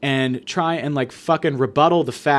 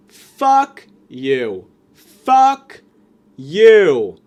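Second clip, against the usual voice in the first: louder, furious outburst; angry shout; 7 dB louder, much slower than usual. Real, not acted. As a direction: blast it back at him. Angry retort to the pious kid.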